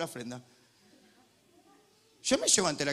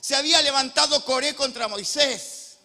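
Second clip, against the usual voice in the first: loud, high, fiery sermon delivery; booming pulpit voice; full-force preaching; 9 semitones higher, 12 dB louder than usual. Acted. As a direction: preach it out to the whole hall.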